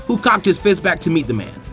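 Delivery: ordinary delivery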